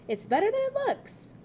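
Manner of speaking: high, mouth full of food; around a mouthful. A narrator's reading. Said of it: "around a mouthful".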